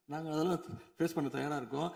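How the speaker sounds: usual speaking voice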